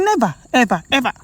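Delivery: "never" pitched high, then "ever, ever" set apart; emphatic refusal. Retold or imitated. Quoting a wife's vow to critics, emphatic refusal. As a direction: lean on each word of "never, ever, ever".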